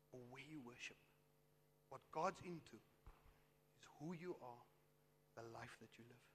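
soft, low voice with long pauses; hushed, solemn preaching. Acted. Preacher relays Christ's words to the woman, hushed and solemn.

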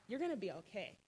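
soft, hushed words to herself; barely voiced, like a thought; inner comforting words. Comforting herself in her mind, hushed and gentle. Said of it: "saying in my, in my mind".

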